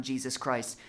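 normal talking voice

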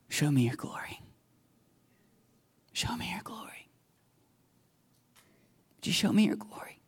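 soft unvoiced pleading whisper; whispered plea. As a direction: breathe it as a longing prayer. Moses pleading to see glory.

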